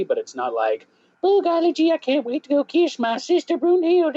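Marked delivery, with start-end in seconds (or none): 1.3-4.2 very high-pitched hillbilly Southern caricature; exaggerated Southern accent. Player showing what they don't sound like, exaggerated Southern accent. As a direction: squeal it in a hammed-up Southern accent. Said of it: "the Southern is there if I want it".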